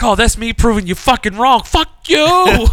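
high, loud re-enacted shout, climbing at the end; triumphant angry retort. Boyfriend proving girlfriend wrong, triumphant shouted retort.

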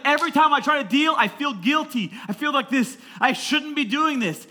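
high, loud imitation of a distraught kid; mock exasperated rant. Teen confessing guilt to his pastor, mock exasperated rant. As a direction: pour it out as a loud complaint. Retold outburst of upset teen.

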